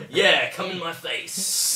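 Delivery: loud, slow, high mock moan; hammed-up porn voice. Friend acting out the porn joke, hammed-up loud slow voice. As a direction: draw it out in mock ecstasy.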